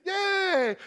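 high mock cheer of toddlers; kids cheering. Toddlers getting caramel apples, high mock cheer. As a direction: squeal like delighted little kids. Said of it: "The kids".

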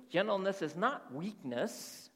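"not" drawn out and leaned on, slow; slow down and press hard on "not"; stress on not. Shown as a demonstration. Pastor drilling the congregation, slow with "not" stressed. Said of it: "Do you hear my enunciation?".

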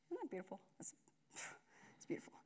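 breathes "it's beautiful" on a sigh; hushed, moved whisper; awed whisper. Real, not acted. Awed by the verse he read.

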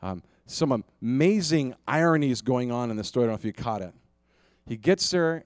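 usual speaking voice

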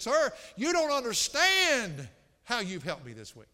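loud, high, drawn-out impassioned quote; preacher's mock-weeping cry, without tears; tearful man, retold. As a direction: imitate an overcome man pouring out thanks. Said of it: "he just wept, and he said".

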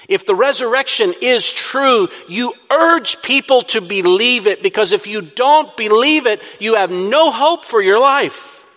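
loud, high, forceful preaching; urging. Shown as a demonstration. Preacher urging a congregation to believe, loud and urgent. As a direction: urge them hard, punching the key words. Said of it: "So you urge".

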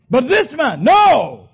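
high, loud protest; thief shouting. Acted. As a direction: cry out to shield the man in the middle. Thief defends Jesus on cross.

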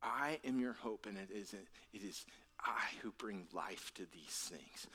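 hushed, intimate voice of comfort; tender reassurance. Retold or imitated. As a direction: reassure the grieving, almost in a whisper. Jesus consoling the grieving, voiced softly.

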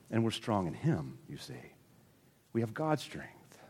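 normal talking voice

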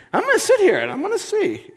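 high, fast mocking whine; sulky prophet sitting it out, voiced high; stubborn Jonah. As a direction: pout it out like a sulking child. Jonah stubbornly waiting for God.

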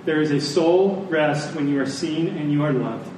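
ordinary talk

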